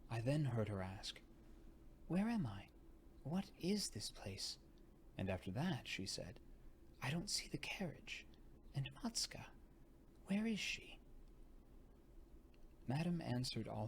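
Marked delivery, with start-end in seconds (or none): 2.1-11.0 put-on high, bewildered girl's voice; lost and confused, searching; dazed young lady. A narrator's reading. Dazed young lady asking questions.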